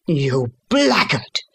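slow, drawn-out angry insult; narrated shout of rage, moderately voiced; enraged shout. A narrator's reading. Father cursing his son, enraged shout. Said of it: "I shouted, beside myself with rage".

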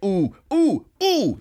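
high, loud "ooh" bursts; imitated spark noises. Retelling an anime showdown as sparks fly, hammed-up whoops. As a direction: whoop out cartoon energy blasts. Mimics sparks flying in clash.

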